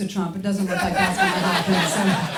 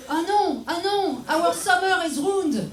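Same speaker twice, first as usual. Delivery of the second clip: high, drawn-out French-accented mock lament; shitty French-accented English; 8 semitones higher, slower than usual. French people hearing the tourist cancelled, mock-devastated French accent. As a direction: wail it theatrically with a thick French accent. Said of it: "in a shitty English".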